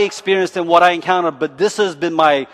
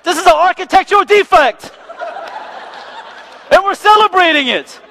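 much higher, louder mock outrage; sarcastic disbelief; 14 semitones higher, 5 dB louder than usual. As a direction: scoff at the monument in disbelief. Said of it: "I'm like".

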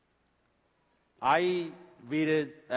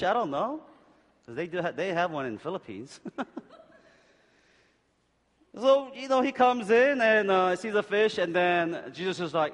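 ordinary talk

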